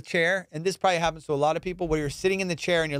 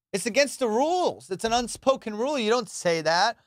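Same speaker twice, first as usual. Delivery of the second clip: usual speaking voice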